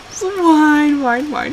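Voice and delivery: teasing mock whine. Owner imitating her crying dog, teasing whine. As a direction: whine along to tease the dog. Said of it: "So that's what I would do".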